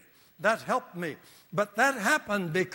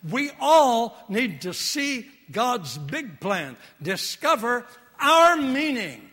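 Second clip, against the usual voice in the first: preaching at full force; 4 semitones higher, 5 dB louder than usual. Acted. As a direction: lift the crowd toward God's plan.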